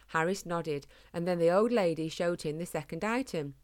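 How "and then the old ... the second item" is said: ordinary delivery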